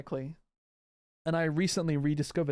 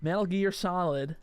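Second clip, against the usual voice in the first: slow cowboy drawl; cowboy accent; 3 semitones higher than usual. Shown as a demonstration. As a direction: stretch it out in a put-on cowboy accent. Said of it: "Put on a cowboy hat".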